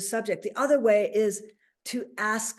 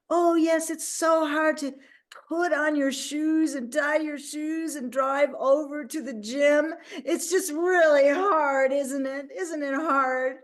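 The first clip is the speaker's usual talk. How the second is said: mock whiny excuse; 5 semitones higher, slower than usual. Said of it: "And I said".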